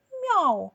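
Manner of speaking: high, loud meowing cry; loud cat meow. A narrator's reading. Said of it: "закричала вона". (гучне нявкання)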